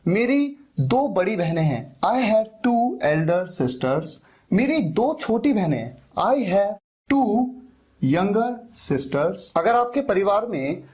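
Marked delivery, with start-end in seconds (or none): 2.0-4.1 each word drawn out for learners; very slow model sentence. Shown as a demonstration. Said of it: "modelling sentence slowly". 6.2-9.5 each word drawn out for learners; very slow model sentence. Shown as a demonstration. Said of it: "modelling sentence slowly".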